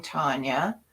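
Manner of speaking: ordinary talk, nothing marked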